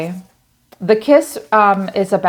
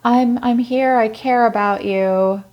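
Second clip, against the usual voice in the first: mock caring voice, pitched up; manipulative sweet talk; 5 semitones higher than usual. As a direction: fake sincerity, laying it on. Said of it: "This person's manipulative".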